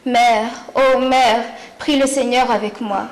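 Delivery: loud, drawn-out poetic appeal; impassioned invocation. Acted. Poet calling on her mother to pray, impassioned invocation. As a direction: call out to her slowly and loudly.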